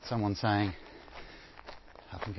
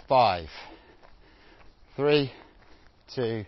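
countdown callout; slower than usual. Real, not acted. Countdown to interval start.

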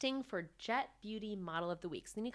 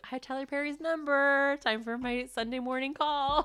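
high, loud gleeful play-acting; mock eagerness; 8 semitones higher, 8 dB louder than usual. Host imagining her calling Tyler Perry, high mock eagerness. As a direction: pretend to be a keen pest of a caller.

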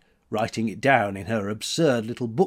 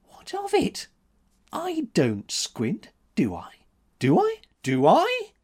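defiant; 12 semitones higher than usual. A narrator's reading. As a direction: step closer with each demand. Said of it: "each repetition of her defiance".

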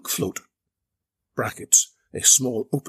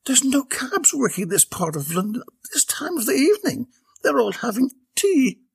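higher, prim knowing lady; Belinda; 12 semitones higher than usual. A narrator's reading. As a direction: explain it matter-of-factly. Belinda voiced in story.

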